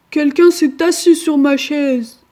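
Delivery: papa bear protesting loudly; grand ours. A narrator's reading. Grand ours voiced in tale. (voix forte du grand ours indigné)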